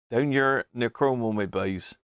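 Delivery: lighter echo of the Cornish yokel voice; half-repeat it in the put-on Cornish accent. Repeating his own joke in a mild Cornish accent. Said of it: "down in Cornwall".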